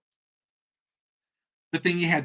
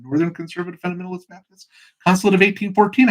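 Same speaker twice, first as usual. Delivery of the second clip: fast, higher, louder recital; rapid-fire answer; 4 semitones higher, 4 dB louder, faster than usual. Acted. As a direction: reel the whole title off in one run. Rattles off absurd denomination name.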